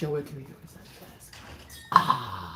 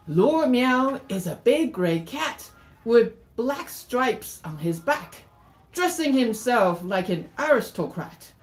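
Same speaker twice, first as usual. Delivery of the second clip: slow, swooping picture-book narration; story read-aloud; 3 semitones higher, 4 dB louder than usual. Acted. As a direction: stretch the key words and let the pitch swoop.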